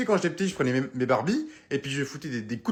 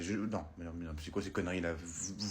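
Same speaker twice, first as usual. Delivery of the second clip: muttered aside; 10 semitones lower, 12 dB quieter than usual. Acted.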